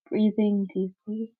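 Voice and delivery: ordinary delivery, nothing marked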